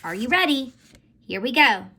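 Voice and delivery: usual speaking voice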